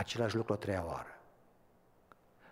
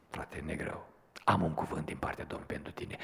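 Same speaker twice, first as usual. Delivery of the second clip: soft whisper, much lower and softer; hushed reply; 6 semitones lower, 3 dB quieter than usual. Retold or imitated. Preacher answering the dying brother, in a hushed whisper. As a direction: lean close and breathe the words to him.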